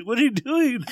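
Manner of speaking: high loud startled question; shocked high yelp. Retold or imitated. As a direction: squeal it like the friend did. Repeating friend's shocked line.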